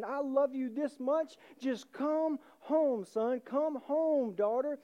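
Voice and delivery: high, slow, loud appeal; fervent preacher's plea; impassioned call home. Acted. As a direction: call out with drawn-out words. Christ on the cross calling children.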